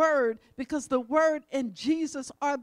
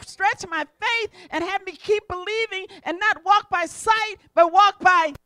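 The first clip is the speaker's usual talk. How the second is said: preaching testimony, building higher and louder; impassioned preaching; 7 semitones higher, 10 dB louder than usual. Acted. Preacher testifying to healing and provision, building impassioned preaching. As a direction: testify with rising conviction.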